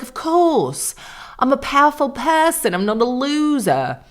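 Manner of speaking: high, swooping Australian impression; hammed-up Australian socialite; mock Australian accent. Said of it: "She does sound Australian to me".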